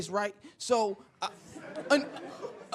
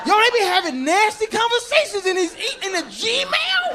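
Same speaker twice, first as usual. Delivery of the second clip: high, loud incredulous holler; scandalised disbelief; 11 semitones higher, 7 dB louder than usual. Acted. Comic appalled at nasty conversations in Gmail, high incredulous holler. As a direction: climb higher and louder in disbelief.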